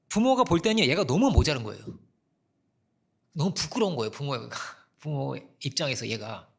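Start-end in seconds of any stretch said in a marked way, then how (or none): none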